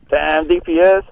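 ordinary delivery

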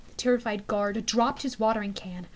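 plain reading voice

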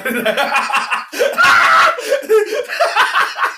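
high, loud burst of laughter; loud laughing shriek. Real, not acted.

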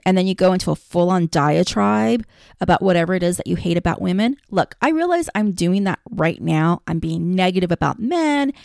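ordinary talk, nothing marked

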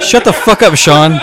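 loud, high comeback; mock outburst. Real, not acted. Telling Sean off for his heckling, mock outburst. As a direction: shout him down, half laughing. Jokingly snapping at heckling friend.

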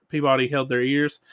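plain reading voice

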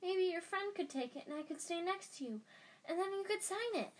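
high, pleading schoolboy voice; imploring Colin. Acted. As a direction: beg eagerly like a young fan. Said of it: "imploringly at Harry".

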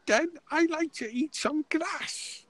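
very high, slow squeaky joke voice; put-on voice. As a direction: squeak it out like a silly cartoon. Continues the silly put-on voice.

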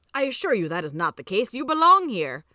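emphatic protest; emphatic insistence. Acted. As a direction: insist firmly, building to the last words. Scrooge insists ghost belongs.

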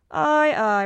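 loud, emphatic agreement; emphatic farmer. A narrator's reading. Farmer answers his godfather, emphatic.

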